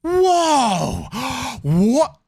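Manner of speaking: loud, high-pitched wail of fake amazement; mock astonishment. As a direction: gasp and cry out as if your mind is blown. Feigning shock at an obvious point.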